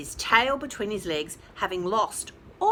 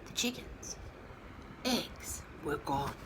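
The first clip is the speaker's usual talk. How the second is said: ordinary delivery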